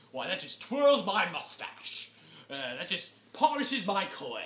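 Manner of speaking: loud, hammed-up pompous tycoon voice; mock old-money indignation; snooty rich villain. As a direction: pontificate loudly like a caricature aristocrat. Mocks snooty rich family's reaction.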